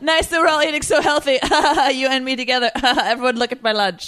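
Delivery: high, loud, hammed-up gushing; mock health bragging. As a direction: play a show-off shopper through laughs. Mocking the health-conscious shopper, laughing.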